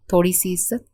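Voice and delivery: ordinary delivery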